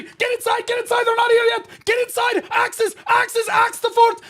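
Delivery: high, loud commanding shouts; rally the troops at full volume; mock shot-caller yell. Streamer mocks his shot caller's orders to storm the fort, yelling. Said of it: "And he's gonna be".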